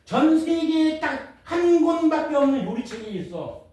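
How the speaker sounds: slow, high, storybook telling; walk a kid through it slowly; explaining to a child. Shown as a demonstration. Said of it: "초등학생도 이해할 수 있어요".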